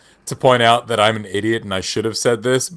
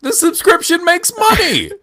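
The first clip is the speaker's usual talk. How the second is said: mocking; 4 dB louder than usual. Mocking the obvious argument.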